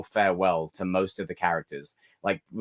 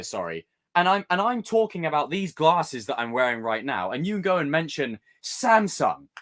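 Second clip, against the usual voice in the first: higher, exasperated ranting; theatrical rant; 8 semitones higher than usual. Pretend. Ranting about people who change the subject, pitched high. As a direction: complain like a dramatic diva. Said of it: "I get very theatrical".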